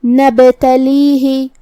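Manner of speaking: نبتلیہ chanted slowly with a bounced b; qalqala on ba. Shown as a demonstration. Teacher demonstrating qalqala on ba, slow bouncing chant. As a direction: echo the ب in نبتلیہ, then stretch the long vowel. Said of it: "با کو ہلاتے ہوئے یہ کلمہ کو پڑھیں گے".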